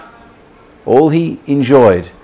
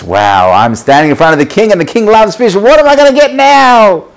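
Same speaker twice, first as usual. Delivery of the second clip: loud, soaring to a high squeal; excited fish; 11 semitones higher, 7 dB louder than usual. Acted. Fish before the king expecting a reward, wildly excited. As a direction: build to bursting with anticipation. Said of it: "you know who's most excited".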